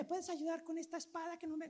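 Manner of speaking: high, soft imitated child voice; little girl. Small girl asks the man for help, high soft child voice. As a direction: pitch it way up like a four-year-old. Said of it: "una niña como de cuatro añitos".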